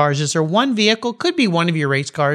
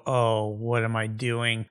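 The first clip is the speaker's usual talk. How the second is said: slow, low, soft muttered thought; hushed, drawn-out self-questioning; retold inner doubt; 4 semitones lower, 8 dB quieter, slower than usual. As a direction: murmur it slowly to yourself as a sinking realization. Voicing his worried thought.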